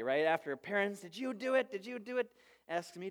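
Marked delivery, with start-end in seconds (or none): 1.0-2.3 accusing. Retold or imitated. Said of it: "she's after everybody".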